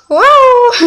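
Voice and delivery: high, loud exclamation; sudden mock alarm; surprised yelp at her own rambling; whoa. Real, not acted. Exclaims at topic jumps.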